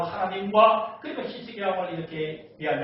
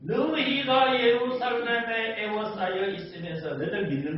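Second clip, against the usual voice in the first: jeering taunt; 5 semitones higher, 4 dB louder than usual. Acted. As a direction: taunt the besieged like a conquering king. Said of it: "라고 비아냥거려요".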